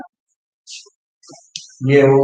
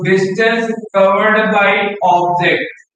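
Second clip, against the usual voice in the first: slow dictation; 2 semitones higher, much slower than usual. Shown as a demonstration. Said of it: "teacher dictating definition".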